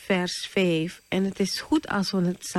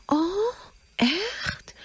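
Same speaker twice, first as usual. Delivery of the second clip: put-on surprised child; 13 semitones higher than usual. Child answers mother in story.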